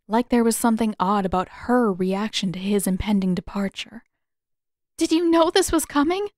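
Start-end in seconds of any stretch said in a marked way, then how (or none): 5.0-6.3 1 distressed question. A narrator's reading. Katara upset, questioning Zuko.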